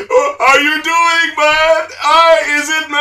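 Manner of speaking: mock Jamaican accent, high and loud. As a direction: put on a randomly exaggerated Jamaican accent. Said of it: "like, the Jamaican accent".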